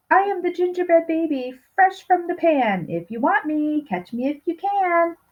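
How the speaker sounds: high, sing-song cookie taunt; high playful gingerbread taunt; gingerbread baby voice. Acted. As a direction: tease them like a cheeky little cookie.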